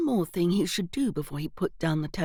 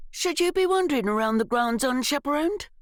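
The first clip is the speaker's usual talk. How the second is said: higher, whiny schoolboy challenge; whiny demand; 8 semitones higher, 3 dB louder than usual. A narrator's reading. Schoolboy challenging Evelyn in the grounds, whiny demand. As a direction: whine it like a smug student. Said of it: "the whiny voice demanded".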